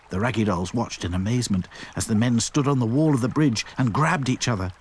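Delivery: plain reading voice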